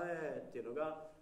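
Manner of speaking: normal talking voice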